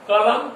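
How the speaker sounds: teacher's demonstration of stressed ल in "कलम". Shown as a demonstration. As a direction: say "कलम", leaning hardest on the ल. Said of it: "कलम में ल पर अधिक जोर है".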